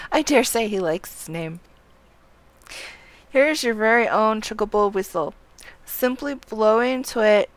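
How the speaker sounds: formal dialogue read-aloud. Acted. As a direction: proclaim it like an official handing over a license. Reads game character's dialogue aloud.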